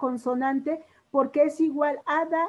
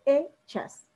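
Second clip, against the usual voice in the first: Hechas split after the strong E; teacher sounding out E... chas in Hechas; stress on E in Hechas; 2 semitones higher than usual. Shown as a demonstration. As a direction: lean on E in Hechas, pause before chas. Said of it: "la vocal fuerte".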